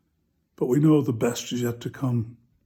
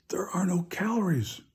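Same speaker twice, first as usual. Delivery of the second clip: hushed punchline; 4 semitones higher, 7 dB quieter than usual. Retold or imitated. Punchline, Peter whispers to Tom.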